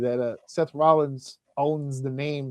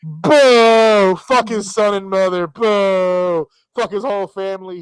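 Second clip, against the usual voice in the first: loud, hammed-up heckling; mock booing; 3 semitones higher, 8 dB louder, slower than usual. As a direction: jeer like a fan in the cheap seats. Mocking fans booing a name.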